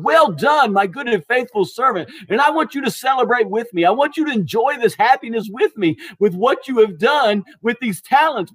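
high-pitched, effusive congratulation; delighted praise. Acted. Master praising his second servant, delighted. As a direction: gush with celebration, phrase after phrase.

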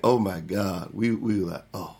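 low, hushed mock dismay; retold dread. Kids hearing their uncle will get them, sinking dread. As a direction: drop low and soft, as kids in trouble.

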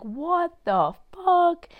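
high, slow, stretched out disbelief; incredulous shocked voice. Pretend. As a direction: drag it out like she can't believe it. Mock reaction of her.

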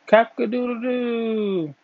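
high, drawn-out cock-a-doodle-doo; happy rooster crow. A narrator's reading. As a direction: crow happily like a rooster saying goodbye. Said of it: "said goodbye to everyone with a happy".

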